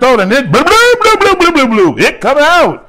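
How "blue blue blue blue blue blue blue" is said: high mock retching 'blue, blue'; vomiting noise. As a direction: act out throwing up with a rising blurt. Acting out throwing up.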